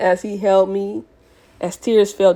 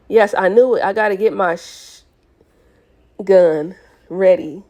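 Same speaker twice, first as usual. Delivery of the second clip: ordinary delivery, nothing marked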